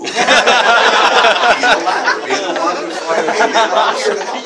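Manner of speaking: high and loud, laughing through it. Real, not acted. Laughing as he tells people to be kind. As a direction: laugh while giving the advice. Laughing at his own joke.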